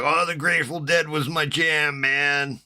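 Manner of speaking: lazy hippie voice; mock stoner.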